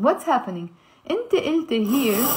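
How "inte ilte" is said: "Inte ilte" slowly, each ending in e; slow "Inte ilte", kasra heard as final e; final e sound. Shown as a demonstration. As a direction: say "ilte" with an e at the end. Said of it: "we pronounce it as a e".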